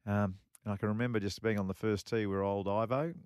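ordinary delivery

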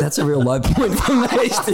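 laughing through embarrassed words. Real, not acted. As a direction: talk while still cracking up. Laughing after spilling coffee on air.

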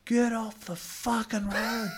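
a driver hollering high and slow at a cyclist; high drawn-out yell. Retold or imitated. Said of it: "Dan goes".

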